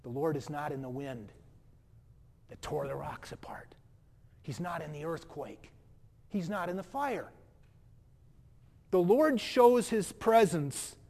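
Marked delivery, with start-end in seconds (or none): 0.0-7.3 quiet hush. Acted. Preacher tells the congregation where God is not, hushed. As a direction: drop to a near whisper. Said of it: "gentle whisper".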